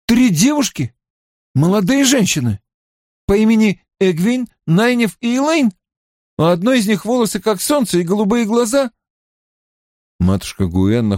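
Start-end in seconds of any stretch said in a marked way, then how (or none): 0.0-8.9 high-pitched, hopeful questioning; pitched-up, keen character voice; eager Mat. Acted. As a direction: press for confirmation of each detail. Narrator voices Mat asking urgently.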